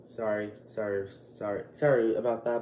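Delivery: soft, dropped-down sorries; hushed, embarrassed sorry; sheepish apology. Real, not acted. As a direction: back off quietly after an outburst. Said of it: "that was a little aggressive".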